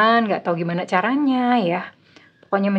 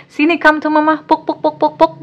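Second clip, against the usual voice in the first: mock comforting; 7 semitones higher, 5 dB louder than usual. Comforting singles playfully.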